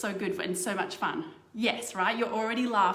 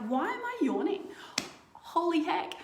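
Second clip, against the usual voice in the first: mock baffled outburst, pitched much higher; puzzled self; 9 semitones higher than usual. Yawning on the train, mock baffled and higher. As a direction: play your own confusion at catching a yawn. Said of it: "and you're like".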